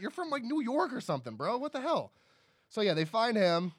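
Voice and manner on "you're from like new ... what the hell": high, exasperated mock protest; mock incredulous. Host scolding actor over his fake origins, mock incredulous. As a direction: call out the absurd casting in high-pitched disbelief.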